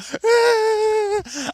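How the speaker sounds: loud high shrieking burst of laughter. Real, not acted. Streamer cracking up at pregnancy symptoms, shrieking laugh.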